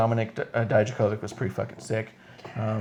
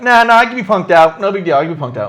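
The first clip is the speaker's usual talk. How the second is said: loud, raised mock-ref voice; mocking impersonation; 6 semitones higher, 10 dB louder than usual. Host plays the ref giving in, loud mocking imitation. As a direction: mimic a pushover, high and loud at first. Mimicking a spineless referee.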